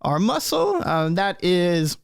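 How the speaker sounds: put-on high old gnome voice; little elderly gnome, pitched high; Burgle Timbers. Old gnome introduces his companions, high elderly voice. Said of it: "Burgle says".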